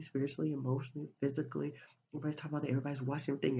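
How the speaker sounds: ordinary talk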